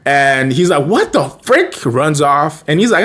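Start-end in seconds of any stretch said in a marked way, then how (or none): 0.9-1.7 much higher panicked outburst; high mock panic; freaked out. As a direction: squeak it out in shock. Ben freaking out again.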